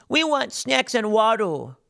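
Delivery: high, drawn-out mock demand; mocking protester voice. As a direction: imitate a whiny protester, stretching each word.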